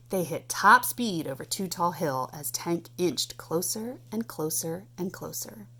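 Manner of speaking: plain reading voice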